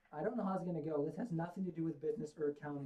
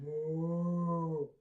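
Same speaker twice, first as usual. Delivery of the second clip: loud hammed-up moo; big mock moo; best moo; 7 dB louder than usual. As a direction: moo like a proud cow. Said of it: "my best moo".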